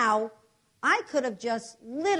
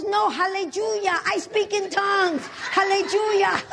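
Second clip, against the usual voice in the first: put-on loud, high, ecstatic Spanish-accented cry; jubilant Spanish accent; 10 semitones higher, 6 dB louder than usual. Maria now speaking in tongues, jubilant Spanish accent. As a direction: shout it joyfully in a mock Spanish accent. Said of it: "She was Spanish".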